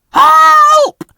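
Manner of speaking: frightened wail. A narrator's reading. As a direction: wail for help in terror. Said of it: "wailed Bill and Ben together".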